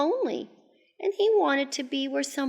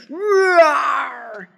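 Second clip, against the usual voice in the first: loud, high, drawn-out roar; playful monster roar; 5 semitones higher, 6 dB louder than usual. Pretend. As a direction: roar along with the children. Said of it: "roared their terrible roars".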